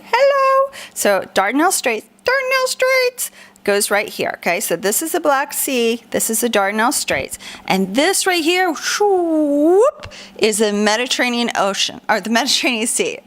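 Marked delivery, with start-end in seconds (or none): 0.0-0.7 squeaky hello. Acted. Teacher playfully calls out. 2.2-3.3 high silly voice. Acted. Teacher hams up the term. 8.7-10.1 sliding whoop. Shown as a demonstration. Sound for the strait's path.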